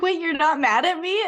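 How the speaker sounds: imitating her own startled relief; surprised disbelief. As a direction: coach amazed her team isn't upset. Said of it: "I'm like".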